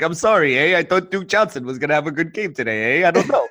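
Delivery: mock-apologetic Canadian lilt; Canadian accent. Host shows off his apologizing Canadian accent. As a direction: play a sorry Canadian with eh tags. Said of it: "about to do my Canadian accent".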